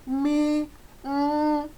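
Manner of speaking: "mi" sung high and level. Shown as a demonstration. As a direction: lift "mi" up to the high tone. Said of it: "that is mi".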